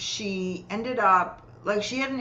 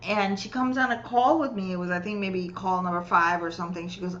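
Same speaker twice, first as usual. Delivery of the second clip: usual speaking voice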